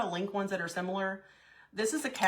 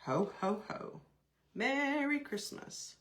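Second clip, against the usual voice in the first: Santa Claus; 5 semitones higher, 3 dB quieter, slower than usual. Retold or imitated. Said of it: "what does Santa Claus say?".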